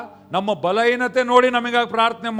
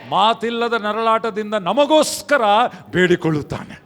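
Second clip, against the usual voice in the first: ordinary delivery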